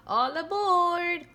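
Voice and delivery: high, loud and slow, like a conductor calling; conductor's high, loud boarding call. A narrator's reading. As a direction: call it down the platform, drawn out. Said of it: "calls the conductor".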